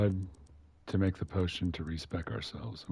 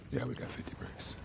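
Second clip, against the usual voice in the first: whisper; 5 semitones higher, 10 dB quieter than usual. Real, not acted. Muttering to himself while crafting.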